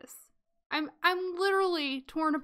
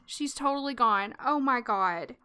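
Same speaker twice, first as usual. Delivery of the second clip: ordinary talk, nothing marked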